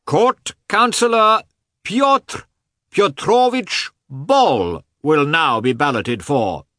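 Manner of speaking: high, loud, drawn-out announcement; crying out. A narrator's reading. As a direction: call the name out across the hall. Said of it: "cried the voice of the man in uniform".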